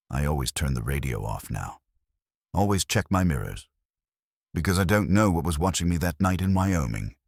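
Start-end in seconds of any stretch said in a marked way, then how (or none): none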